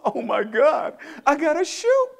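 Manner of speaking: high, loud alarm; high-pitched mock panic; panicked player. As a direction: imitate a player panicking under pressure. Player panicking with ball at half court.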